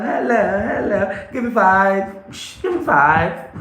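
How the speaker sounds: bright, high classroom voice; teacher voice for little kids; demo teacher greeting. Shown as a demonstration. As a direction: greet young pupils with energy.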